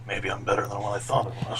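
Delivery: fast, hushed smug aside; whispering. Acted. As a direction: whisper it, pleased with himself.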